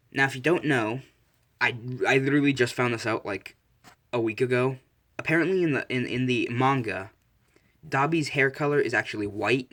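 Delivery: usual speaking voice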